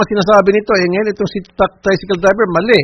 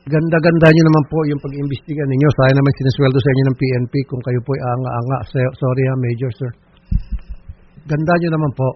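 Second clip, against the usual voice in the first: low, soft sarcastic compliment; dry mock praise, voice dropped low; 7 semitones lower, 5 dB quieter than usual. Real, not acted. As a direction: scold quietly under cover of politeness. Host sarcastically praises major's investigation.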